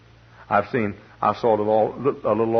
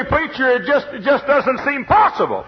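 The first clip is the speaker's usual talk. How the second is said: high, loud mock whine of a doubter; doubting listener; 7 dB louder than usual. Preacher mimics a doubting churchgoer, high and loud.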